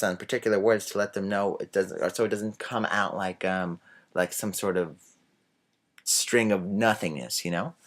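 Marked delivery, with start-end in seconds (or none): none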